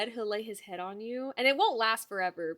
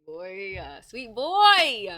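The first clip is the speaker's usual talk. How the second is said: doting coo; 8 dB louder, much slower than usual. Real, not acted. Cooing at dog.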